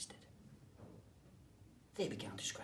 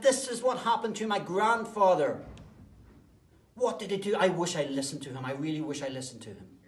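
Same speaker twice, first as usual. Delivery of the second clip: loud, higher fretting voice; panicked regret; 6 semitones higher, 13 dB louder than usual. Acted. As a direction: wail your regret to the trees. Granddaughter regrets not listening.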